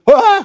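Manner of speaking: petit cri aigu de patient paniqué; cri soudain, très aigu; cri de peur. Acted. Patient chez le dentiste prend peur. (cri effrayé du patient chez le dentiste)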